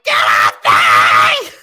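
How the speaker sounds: low, slow, loud imitation; mock deep yell. Sable shoving off security, slow low shout. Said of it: "and Sable's like".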